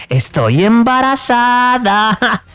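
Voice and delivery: slow, high, drawn-out reveal; wife's announcement. Acted. Wife announcing pregnancy to husband, slow high reveal. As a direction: stretch the big news out as the wife. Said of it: "La mujer le dice".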